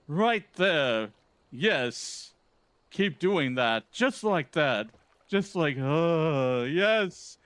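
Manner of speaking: pompous gentleman hero, brisk and theatrical; Hildebrand. Acted. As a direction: read it like a vain, grandiose gentleman. Voicing Hildebrand's quest lines.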